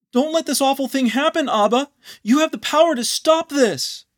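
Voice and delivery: high, loud, tearful begging; sobbing plea. Acted. Anon sobbing against the door, begging Abba to stop it, high and tearful. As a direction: cry out, pleading through tears. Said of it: "leaned against the door and sobbed".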